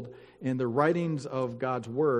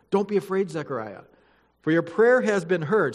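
louder, formal Bible reading voice; scripture reading; 4 semitones higher, 6 dB louder than usual. Acted. Pastor reading Luke to the church, formal and solemn. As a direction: read it out to the congregation with weight.

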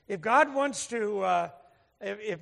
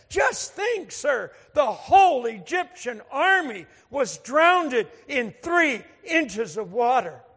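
marveling, loud and slow punchline; acted amazement; 6 dB louder, slower than usual. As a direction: build it up in wonder, stretching each phrase. Convert's gleeful punchline to the speaker.

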